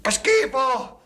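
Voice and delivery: high, loud cry of revulsion; disgusted shout. Acted. Man tasting bitter coffee, loudly disgusted. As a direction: recoil loudly from a foul taste.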